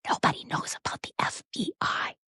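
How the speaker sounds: hushed urgent whisper, imitating him; mock secretive whisper; imitated whisper. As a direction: whisper it as if hiding from cameras. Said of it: "he's whispering".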